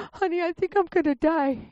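high, pleading voice; retold fear. To husband Mark at the bedside, high and afraid. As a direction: tell him you're dying, frightened. Telling husband she will die.

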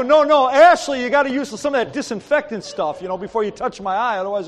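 loud, high, urgent objection; mock alarm. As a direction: cry out to stop a helper. Alarmed at unwashed hands near eye.

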